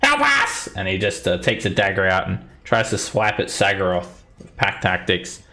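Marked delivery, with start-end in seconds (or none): 0.0-0.7 very high, squealing kobold cries; panicked, high-pitched kobold yells; yelling kobold. Acted. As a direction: yell for help as a terrified little creature. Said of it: "he yells to you guys in common".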